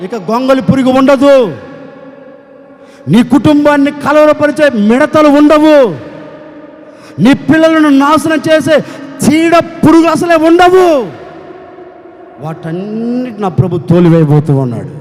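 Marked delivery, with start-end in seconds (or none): 0.1-10.9 preaching declaration. Acted. Sermon declaration, rising.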